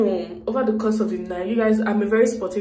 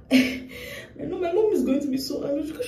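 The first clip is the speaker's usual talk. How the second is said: laughing through tears; 8 semitones higher than usual. Real, not acted. Laughs and cries about mom.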